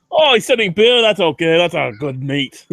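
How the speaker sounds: mock Irish border guard; Irish accent. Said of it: "the Irish 'cause they're like".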